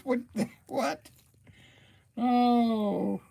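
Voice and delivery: taken aback by an odd comment; startled disbelief. Real, not acted. Reacting to a live chat comment.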